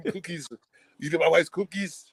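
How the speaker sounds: high-pitched action-hero impersonation; impersonated film-hero complaint; Arnie. Acted. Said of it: "That Arnie impression is great".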